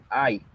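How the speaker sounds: I said as ai, like stubbing a little toe; a short ai, as if you hit your little toe. Shown as a demonstration. Teacher demos the letter I, sound ai. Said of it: "como si se pegaran en el dedo chiquito".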